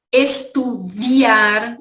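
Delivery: Estudiar, very slow, es-tu-di-AR; careful pronunciation. Shown as a demonstration. Teacher stresses the spelling with i for students. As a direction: draw out the i in Estudiar. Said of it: "se escreve com I".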